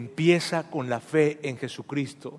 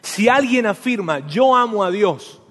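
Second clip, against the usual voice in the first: raised tone; 4 semitones higher, 7 dB louder than usual. Retold or imitated. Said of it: "Juan sube el tono".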